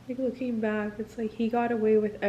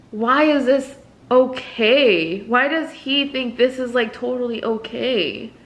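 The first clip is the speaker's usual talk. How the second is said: outraged; 3 semitones higher, 7 dB louder than usual. Real, not acted. Outraged rhetorical question.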